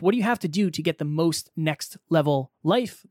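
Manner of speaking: ordinary talk, nothing marked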